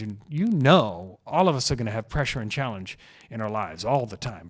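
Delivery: normal talking voice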